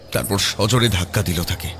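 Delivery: ordinary delivery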